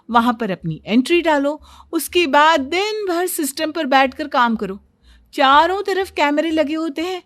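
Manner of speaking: animated, emphatic boasting; swooping, self-assured storybook character voice; know-it-all daughter-in-law. Acted. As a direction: lecture them like an expert on everything. Daughter-in-law boasts about knowing everything.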